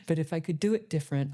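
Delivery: normal talking voice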